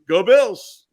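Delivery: bright fan cheer; loud cheer. Shown as a demonstration. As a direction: cheer it for the crowd. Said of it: "the best is how you would normally say it".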